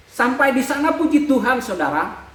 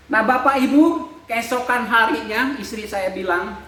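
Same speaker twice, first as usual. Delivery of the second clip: ordinary talk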